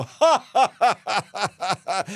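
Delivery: high, loud put-on laugh that fades; mocking cackle. Clerk Messy Jessie laughs at the new guest, cackling. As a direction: cackle at the guest, then trail off. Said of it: "And she go".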